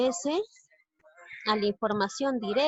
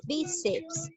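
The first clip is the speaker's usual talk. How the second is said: Bíceps with raised BI, less forceful; stressed first syllable; 5 semitones higher, 5 dB quieter than usual. Shown as a demonstration. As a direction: lift the BI in Bíceps, softer voice. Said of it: "A ver, analizamos".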